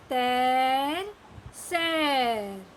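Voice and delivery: तैर and सैर stretched out very slowly; slow model reading. Shown as a demonstration. Teacher reading words slowly for children to echo. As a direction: draw out तैर, सैर syllable by syllable. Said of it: "teacher के पीछे repeat करते जाइए".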